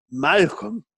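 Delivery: dreamy awe at the name Malcolm; awed. Retold or imitated. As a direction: say Malcolm as if it's exotic and wonderful. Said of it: "it's like".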